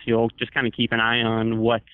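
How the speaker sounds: ordinary talk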